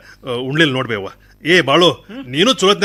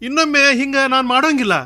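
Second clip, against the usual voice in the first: raised, high-pitched pleading; insistent apology; 7 semitones higher, 4 dB louder than usual. Acted. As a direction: plead to be forgiven, voice up. Son apologises again to upset mother. (ಏರಿದ ದನಿಯಲ್ಲಿ ಕ್ಷಮೆ ಕೇಳುವುದು)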